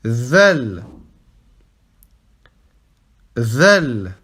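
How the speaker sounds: Zel voiced with the tongue between the teeth. Shown as a demonstration. Teacher repeating Zel for students, tongue between teeth. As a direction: buzz Zel through the teeth, low. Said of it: "langue entre les dents".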